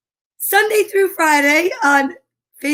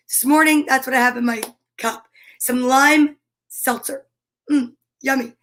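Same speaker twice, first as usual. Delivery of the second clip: ordinary talk, nothing marked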